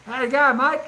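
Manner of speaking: loud, high-pitched broad Australian; mock Australian accent. Imitating an Australian client in London, loud Australian accent. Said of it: "that kind of Australian".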